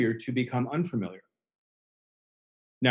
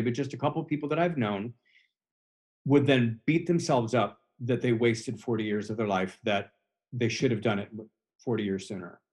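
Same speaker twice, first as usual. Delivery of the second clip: ordinary talk, nothing marked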